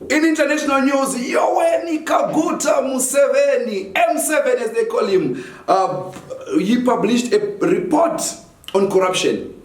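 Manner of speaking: high announcer voice, slow; news anchor. Acted. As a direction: read it like a bulletin. Mock international news read.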